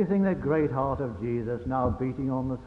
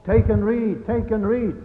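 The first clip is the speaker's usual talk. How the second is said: loud raised call from over the wall; 8 semitones higher, 7 dB louder than usual. Acted. A voice calling over the garden wall, loud call.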